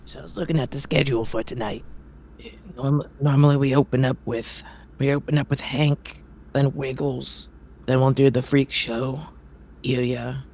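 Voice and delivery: a gruff, impatient circus ringmaster scolding. Acted. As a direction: grumble at the late performer. Ringmaster reads schedule.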